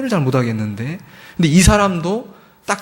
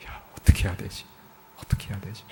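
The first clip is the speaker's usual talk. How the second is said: breathless whispered worry, fading to almost nothing; anxious whisper; 5 semitones lower, 8 dB quieter, faster than usual. Retold or imitated. Missionary in a jail cell whispering to himself in panic. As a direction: murmur it to yourself like a frightened prisoner.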